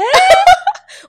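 high, loud cackle; loud laughter burst. Real, not acted. Woman cracking up over the ex's text, loud laugh.

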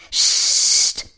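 urgent long hiss; shushing. A narrator's reading. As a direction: hush her with a sharp hiss. Princess shushes her noisy friend. (zischendes Psst)

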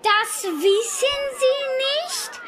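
slow, rising disbelief; can't believe she doesn't know; amazed child. Acted.

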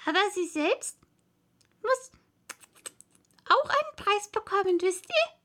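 high-pitched little mouse voice. A narrator's reading. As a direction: pipe up high like a tiny mouse. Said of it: "sagte die Maus".

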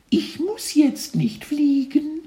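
very high little voice, slow, stress on jetzt; cheeky caterpillar, rising at end; cartoon kid voice. A narrator's reading. Caterpillar repeats line.

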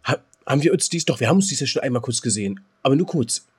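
usual speaking voice

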